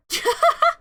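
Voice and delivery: high villainous cackle; high shrieking evil laugh. Acted. Villain laughs after threat.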